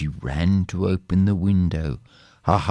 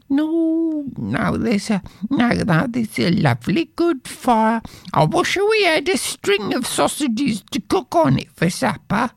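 high, complaining poor wife; wife's voice. Acted. Wife grumbling to her husband about poverty, high voice. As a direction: sigh over being poor.

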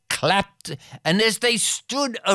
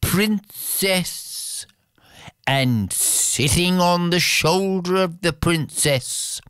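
ordinary delivery

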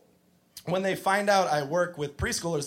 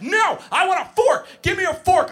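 put-on high, loud bratty toddler demand; screaming preschooler; 14 semitones higher, 5 dB louder than usual. Teacher mimics the boy yelling for a fork, high and loud. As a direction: yell it like a kid on the edge of a tantrum. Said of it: "He's like".

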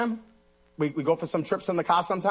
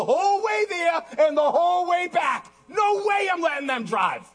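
high, insistent declaration; emphatic preaching; 12 semitones higher, 4 dB louder, slower than usual. Real, not acted. Father telling congregation about driving his kids, emphatic preaching. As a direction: hold the words high and forceful.

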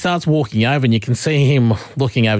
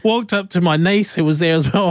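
usual speaking voice

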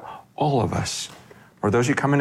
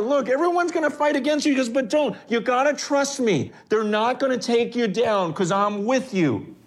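much higher, quick, urgent sermon voice; fervent preaching; 11 semitones higher than usual. Acted. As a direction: drive the promise home high and fast. Preaching God's reassurance.

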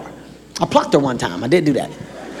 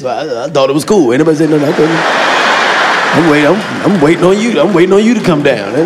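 lower stammering excuse voice; 6 semitones lower, 4 dB louder, faster than usual. Retold or imitated. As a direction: caught out, stammering low excuses. Caught man stammering excuses.

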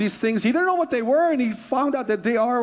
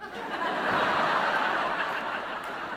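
laugh; 6 dB quieter than usual. Real, not acted. Laughing at thrown-away items.

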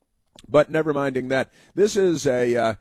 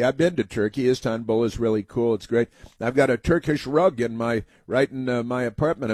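ordinary talk

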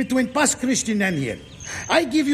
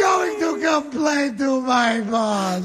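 tearful lament; 4 semitones higher than usual. Acted. Trucker and lady crying together over the fight. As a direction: sob it out.

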